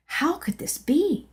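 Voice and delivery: ordinary delivery, nothing marked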